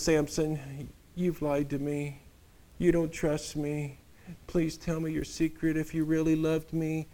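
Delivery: soft, pouting wheedle; pouty pleading. Retold or imitated. Delilah pouting at Samson for his secret, soft pouty plea. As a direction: put on a sulky lip and plead. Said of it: "Insert Poochie lip here".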